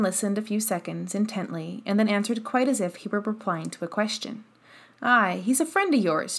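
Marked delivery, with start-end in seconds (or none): none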